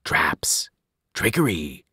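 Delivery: voiceless ghostly whisper. A narrator's reading. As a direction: hiss it from the shadows. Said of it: "they whispered".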